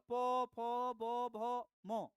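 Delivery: प, फ, ब, भ, म drawn out one by one; labial प, फ, ब, भ, म. Shown as a demonstration. Teacher showing how lips move, प to म sounded slowly. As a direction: press the lips into each slow प, फ, ब, भ, म. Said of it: "इसमें कैसे ओंठ लपक रहा है".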